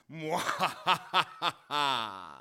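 maniacal villain cackle; mad scientist. Acted. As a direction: cackle like an evil genius. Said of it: "I do the maniacal laughter around here".

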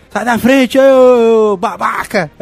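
loud, high shout, drawn-out insult; Wolverine shout. Retold or imitated. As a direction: shove him aside and yell. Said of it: "aí diz".

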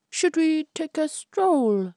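high, slow courteous suitor voice; gallant young man. Acted. Albert invites Florence on a stroll, high and slow. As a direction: offer it slowly like a gentleman.

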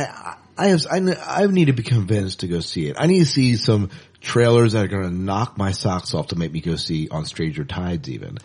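usual speaking voice

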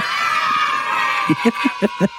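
breathy cackling laugh. Real, not acted. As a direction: burst out laughing. Bursts out laughing.

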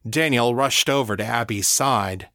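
usual speaking voice

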